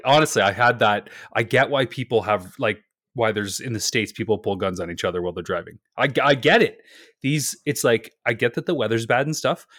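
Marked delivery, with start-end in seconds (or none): none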